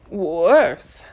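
loud, strangled panic; choked alarm. Acted. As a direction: sputter out questions in alarm. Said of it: "Carter made a choking sound".

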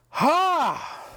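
sharp high mocking "Ha!"; a short gloating bark of a laugh; mocking "Ha!" aimed at his wife; mock cackle.

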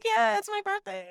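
high-pitched, laughing imitation; giddy playing along. Woman accepting birthday wishes she faked, giddy. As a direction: play along giddily with the fuss.

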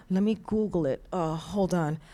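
soft, low aside while searching; distracted stalling. Preacher mimicking someone searching for a verse, quiet and distracted. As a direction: stall quietly as if looking something up.

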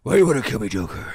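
loud hammed-up Batman growl; deep Batman voice. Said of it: "fucking does that deep voice".

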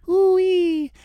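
very high, loud little squeal; tiny spider. Shown as a demonstration. Refining the tiny spider voice, high loud squeak. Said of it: "but it's a tiny spider".

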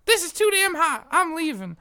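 high, loud whiny lady voice; imitating a lady complaining about the heat; mock lady complaining. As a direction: play the fed-up lady leaving in a huff.

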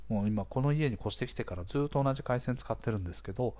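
usual speaking voice

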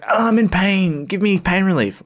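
high, whiny mock moan; mock pleading patient. Patient begging staff for pain relief, mock pleading. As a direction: beg in a put-on pained voice.

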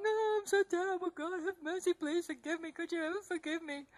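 soft, tearful begging for mercy; crying prayer. Retold or imitated. Begging God for mercy, soft and tearful. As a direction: plead quietly through tears.

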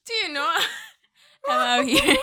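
words squeezed out through laughter; laughing hard. Real, not acted. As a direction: try to talk while cracking up. Laughing fit.

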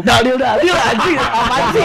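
laughing while talking; high, giggly outburst. Real, not acted. Laughing at friend.

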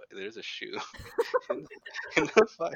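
words breaking up into laughs; cracking up while quoting; laughing retelling. Retold or imitated. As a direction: imitate baffled parents through laughter. Retelling parents' discovery while laughing.

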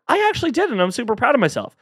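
squeaky high, fast, proud voice; mock proud listener. Host voices a proud listener who washed the car. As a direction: play the chuffed friend, very high. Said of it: "acted exchange example".

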